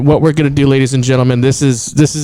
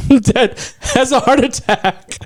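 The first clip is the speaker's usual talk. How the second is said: laughing; 10 semitones higher, slower than usual. Real, not acted.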